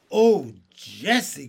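loud, slow, drawn-out excited call; a boy amazed by blueberries; excited cry. A narrator's reading. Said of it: "cried Benny".

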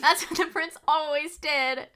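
hammed-up onlooker crying out; amazed crowd. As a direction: echo the mock astonishment, high and loud. Echoing the pretend crowd's amazement.

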